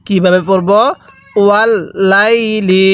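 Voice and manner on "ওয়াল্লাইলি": slow ওয়াল্লাইলি with held l and long লাই; read-along recitation. Shown as a demonstration. Teacher has learners repeat after them, slow drawn-out ওয়াল্লাইলি. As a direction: stretch the middle of ওয়াল্লাইলি evenly. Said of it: "এরকমভাবে শিখব".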